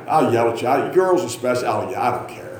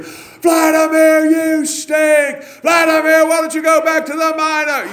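loud, high, drawn-out ballpark heckle; heckling shout; 6 dB louder, slower than usual. Retold or imitated. Preacher retelling his heckling of an outfielder, high drawn-out shout. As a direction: yell it across the stadium in long high notes. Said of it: "I found out [name] heckled ball players".

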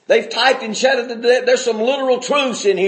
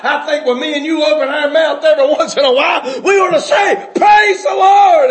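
shouted praise; 7 semitones higher, 5 dB louder than usual. Real, not acted. Preacher urging the congregation, full shouted praise.

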